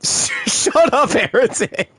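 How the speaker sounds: high, laughing protest; laughing retort. Real, not acted. Streamer telling heretic off, laughing and high-pitched. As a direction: squeal it through laughter.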